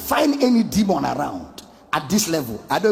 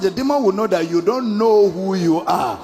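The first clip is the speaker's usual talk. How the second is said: ordinary talk, nothing marked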